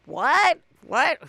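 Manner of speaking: high rising incredulous question; mock disbelief. Bose reacting to Dorit's confession, acted-out disbelief. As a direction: imitate a stunned double take, pitch jumping up.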